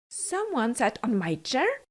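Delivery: ordinary delivery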